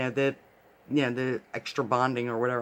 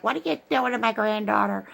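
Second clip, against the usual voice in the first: higher, a hint of a frantic grandma; alarmed grandmother; 6 semitones higher than usual. Grandmother storming the dentist's office, higher and alarmed. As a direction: ask it like a worried granny bursting in. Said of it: "my grandmother was like".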